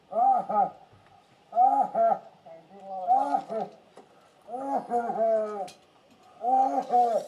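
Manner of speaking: loud breathless groaning cries; real anguished groaning; pained groans. Real, not acted. Restrained man groaning in pain.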